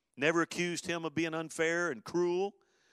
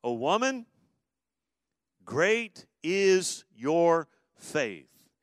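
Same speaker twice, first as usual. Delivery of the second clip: louder, drawn-out proclamation; Jesus praising; 4 semitones higher, 8 dB louder, much slower than usual. Retold or imitated. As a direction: proclaim it slowly like a blessing. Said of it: "Then Jesus answered her".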